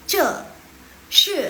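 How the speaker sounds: "Ce shi" shouted high and loud, falling hard; tone with head movement. Shown as a demonstration. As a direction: throw "Ce shi" down with a nod. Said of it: "kepalanya juga ikut". ("Ce shi" keras dan tinggi, ikut gerak kepala)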